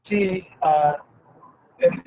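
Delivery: audio cutting out; broken-up call audio; corrupted glitchy audio. Real, not acted. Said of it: "your audio is cutting out".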